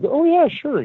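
high, breezy "oh yeah, sure"; casual guy voice. Retold or imitated. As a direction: toss it off lightly, way up high. Voicing the barn nurse.

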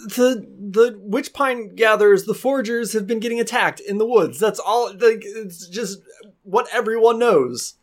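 high, hesitant, bewildered voice; flustered townsman. Acted. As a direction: stammer like a startled villager. Game master plays a flustered townsman.

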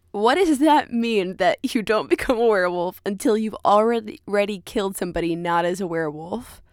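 ordinary delivery

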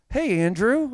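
high-pitched mock friendly hail; stranger's greeting. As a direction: imitate someone who barely knows you calling out. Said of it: "when someone doesn't know me".

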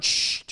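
long, firm shushing sound; hushing shh. Retold or imitated. Holy Spirit silencing the pastor, firm shush. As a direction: hush someone with a drawn-out shh. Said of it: "And the Holy Spirit's going".

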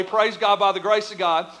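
ordinary talk, nothing marked

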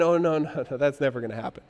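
low, dismissive brush-off; muted, controlling refusal. Acted. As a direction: shut it down in a low voice. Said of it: "isn't it, between this and".

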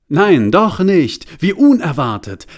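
high, loud, slow take-back; mock surprise. A narrator's reading. Narrator playfully retracts that everyone died. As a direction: pull back with a hammed-up gasp.

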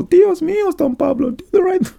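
high, mock-whiny wife pleading; hammed-up high nagging wife. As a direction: squeal it like a nagging young wife. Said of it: "there she is, all".

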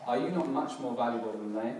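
normal talking voice

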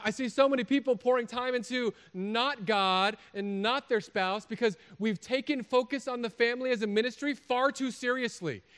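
high, loud impassioned sermon; forceful preaching. Real, not acted. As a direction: push it out to the whole congregation, high and loud.

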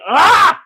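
loud high exasperated ah; sudden frustrated yelp; frustrated cry. Real, not acted. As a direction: burst out in frustration. Frustrated about no new movies.

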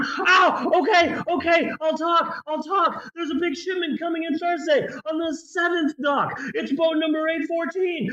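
high, fast panicked babbling; a snitch caving in instantly, high and hurried; desperate cracking pleading. Retold or imitated. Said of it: "it's like".

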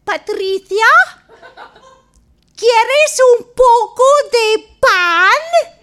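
put-on high, loud, slow old mother offering bread; a very high, slow, shouted old woman's voice; old Spanish mother. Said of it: "Here's Amelia, his mother".

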